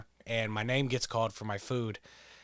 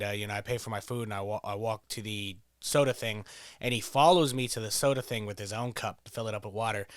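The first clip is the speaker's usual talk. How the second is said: usual speaking voice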